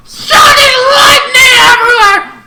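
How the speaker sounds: high, loud furious mock yell; hammed-up furious battle shout; pissed off. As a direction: scream it like an enraged villain. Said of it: "The other one goes pissed off".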